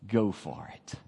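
low, quiet dare at the devil; calm dismissive challenge to the devil; defiant dare. Retold or imitated. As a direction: shrug off the threat, unafraid. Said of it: "look at that old devil and say".

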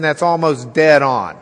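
normal talking voice

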